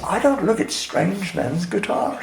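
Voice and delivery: slow, soft, prim put-down; lightly voiced stiff stranger; prim brush-off. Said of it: "she goes".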